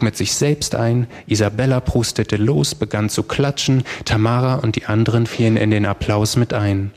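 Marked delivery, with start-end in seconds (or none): none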